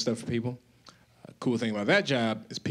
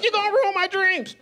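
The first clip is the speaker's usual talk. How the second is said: high, loud wail; high whiny complaint; 4 dB louder than usual. Retold or imitated. Drinker begging cocaine, high loud whine. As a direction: whine like your dreams are dying. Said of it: "He's like".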